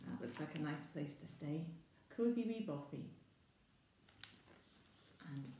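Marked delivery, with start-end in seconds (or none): none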